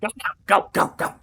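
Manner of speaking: loud shoo them off. Acted. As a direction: hurry them along, loud. Mother shooing again.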